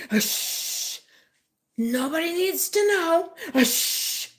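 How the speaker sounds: high, loud outburst between long hushes; loud, hissed hush around a high-pitched protest; loud mock shushing. Retelling the relative who wants it all kept secret, loud mock shushing.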